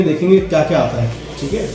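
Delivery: usual speaking voice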